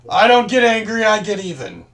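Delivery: loud. Acted. Tough retort in character.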